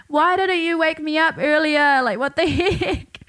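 mock outrage at dad for not waking her; exasperated blame. Blaming dad while rushing.

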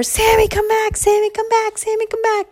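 high, loud frantic calls; repeated pleading squealed shout; calling out. Acted. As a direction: call after a lost friend in acted panic. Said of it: "they started calling out for her".

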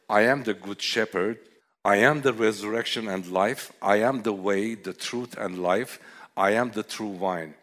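proclaiming scripture. Acted. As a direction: ring out every I am like a proclamation.

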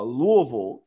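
usual speaking voice

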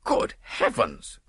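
slow, drawn-out exclamation of dismay; distracted cry. A narrator's reading. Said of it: "cried the distracted nobleman".

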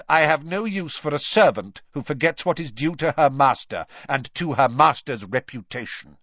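emphatic, haughty dismissal; cold. A narrator's reading. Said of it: "he said coldly".

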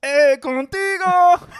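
put-on high, drawn-out Spanish-accent call. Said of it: "with a, an accent".

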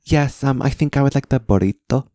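prim fake-British order; Dorit's accent. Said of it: "and she goes".

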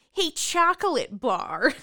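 slow, loud, high-pitched call-out; mock movie-heroine jeer; Marnie's taunt. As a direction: call it out like a taunt, stretching each word. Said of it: "and Marnie says".

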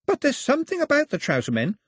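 high, wonder-filled storyteller; enthusiastic Pilou. Acted. Pilou tells Mao of trouser men's wonders, high and enthusiastic. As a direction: marvel aloud, pitched well up.

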